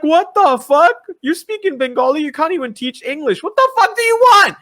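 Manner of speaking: high, exasperated yelling, building to a shout; worked-up, high-pitched rant rising into a yell; exasperated outburst. Retold or imitated. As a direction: blow up at impossible critics. Acts out exasperated reply to critics.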